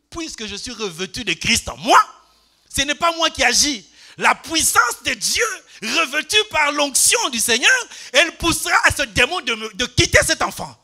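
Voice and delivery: loud, fervent preaching declaration; full-force preaching. Acted. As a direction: thunder out God's power over the demon. Preacher declares God's power. (prédication fervente et forte)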